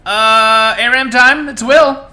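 loud, high, upbeat greeting; excited intro. Real, not acted. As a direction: pump up the stream opening. Energetic stream intro greeting.

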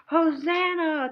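very loud, high, long cry of praise; big shout. Shown as a demonstration. Teacher leading kids to shout together, very loud long cry. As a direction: lead everyone in one big shout. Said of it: "Let's all shout".

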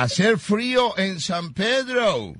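sing-song pretend English question; mock English. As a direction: ask it like broken English. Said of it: "si hace frío en inglés".

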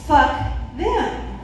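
ordinary talk, nothing marked

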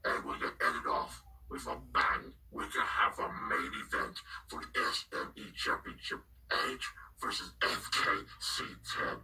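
hushed whisper calling the show; whispered commentary. Real, not acted. Host whispering the pay-per-view announcements. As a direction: keep it all a whisper like a secret broadcast.

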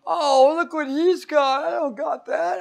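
very high, drawn-out envious whine; covetous whine. Acted. Person eyeing another's things, covetous whine.

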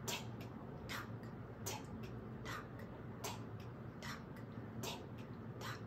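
breathy whispered tick-tock, clock-like. Pretend. Singer whispers the clock ticking to children.

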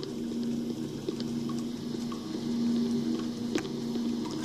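sharp quiet breathless gasp; startled gasp. Real, not acted. Startled in dark game room.

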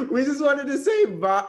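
high, loud, slow, voice breaking with excitement; voice cracking. Real, not acted. Nervous fan saying bye to host's husband, voice cracking. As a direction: squeal it until the voice cracks. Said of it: "my voice is cracking".

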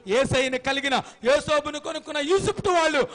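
loud, high preaching at full force; fiery preaching. Acted. Preacher loudly rebuking the congregation, full force. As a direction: preach it out with fire.

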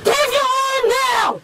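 high, slow shout. Real, not acted. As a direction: shout it out, dragging words. Shouting during arrest.

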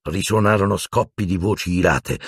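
ordinary delivery

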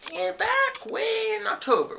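very high, loud, drawn-out call; flashback call. Pretend. Vlogger going back in time to October, high call. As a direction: stretch it out high as if travelling back.

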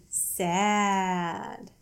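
usual speaking voice

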